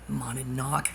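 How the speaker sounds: ordinary talk, nothing marked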